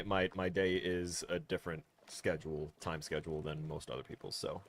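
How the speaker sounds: ordinary delivery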